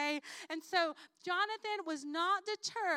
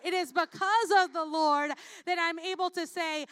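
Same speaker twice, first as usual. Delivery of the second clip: louder, driving preaching voice; 6 dB louder than usual. Acted. Preacher testifying to the congregation, rising loud preaching. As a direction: preach it home to the church.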